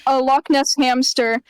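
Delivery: usual speaking voice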